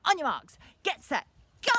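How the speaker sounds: high, loud countdown; race starter's shout. Pretend. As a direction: holler it, building to a long GO. Starting the run down garden.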